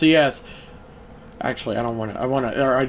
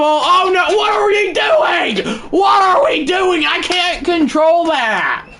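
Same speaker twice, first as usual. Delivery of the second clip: much higher desperate wailing shout; frustrated cry; 7 dB louder, slower than usual. Real, not acted. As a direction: cry out in real frustration at the mistake. Frustrated at his own misplay.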